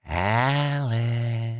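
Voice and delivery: slow, lilting call of her name; sing-song corpse voice. Acted. Dead John calling Alice through the door, sing-song. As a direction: croon her name like an old threat. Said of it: "The sing-song tone he used".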